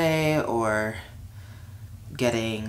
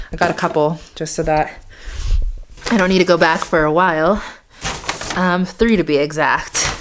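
put-on Yorkshire accent; 3 semitones higher, 3 dB louder than usual. Vlogger chatting to viewers about snacks in a light mock Yorkshire accent. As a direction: playfully try on a Yorkshire accent picked up from YouTubers. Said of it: "so I've been putting on this accent".

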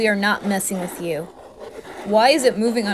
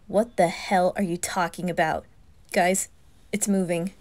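normal talking voice

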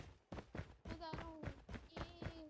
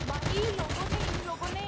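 much louder, pitched up; loud exclamation; 4 semitones higher, 9 dB louder than usual. Real, not acted. Player complains to squad about others, loud exclamation. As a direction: raise the voice sharply to the squad. Calling out loudly during play.

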